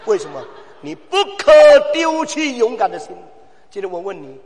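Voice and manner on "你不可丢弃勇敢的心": high, loud pulpit cry; loud preacher's exhortation; fiery preaching. Acted. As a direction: thunder the warning at the congregation. Preaching at full force.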